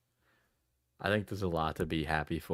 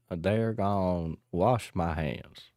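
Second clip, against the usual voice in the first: slower, heavier Texas drawl; Texas accent; slower than usual. Pretend. Streamer hamming up a Texas accent. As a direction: stretch it out like a cowpoke. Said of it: "cowboy accent line".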